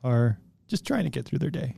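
soft, weepy mock sob; crying voice. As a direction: pretend to cry it out. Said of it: "You have an adorable crying voice".

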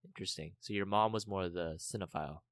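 ordinary delivery

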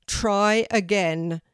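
loud, slow command; booming tree teacher. A narrator's reading. Oak tree orders Kelty to retry, booming. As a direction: boom it out, stretching the words. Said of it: "said a deep, loud voice".

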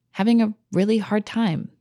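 ordinary talk, nothing marked

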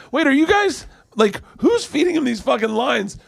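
high-pitched, baffled questioning; mock incredulous. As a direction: squeal the question in comic disbelief. Retold disbelief at garbled lines.

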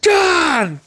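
high-pitched, eager yell; hammed-up action-hero cry; mock battle shout. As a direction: shout it as you launch a kick. Shouted answer to dream command.

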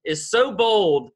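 hammed-up outrage at a cheeky listener, half laughing; incredulous inner voice. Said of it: "and I'm thinking".

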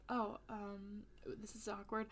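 hushed, awkward aside; whisper. Retold or imitated. Detectives at the dead end of the hallway, whispering. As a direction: murmur it like an embarrassed cop.

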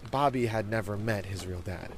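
ordinary delivery

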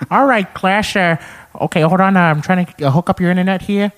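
goofy cartoon dad doing tech support; Peter Griffin. Shown as a demonstration. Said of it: "Family Guy voices".

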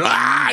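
sudden high mock scream, imitating a startled man; a startled yelp, retold. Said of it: "He, like, screamed".

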